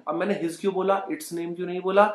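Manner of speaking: ordinary delivery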